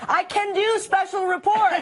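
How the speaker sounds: news anchor voice. Pretend. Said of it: "her audition for special report".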